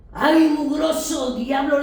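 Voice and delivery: slow, loud exasperated scolding; mock angry outburst. Preacher retelling how she scolded the devil, slow and loud. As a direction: grumble it out as if fed up with the devil. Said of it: "y yo me enojé".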